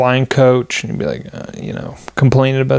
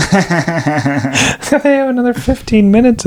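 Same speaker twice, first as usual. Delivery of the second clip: laughing; 8 semitones higher, slower than usual. Real, not acted. Laughing about long set.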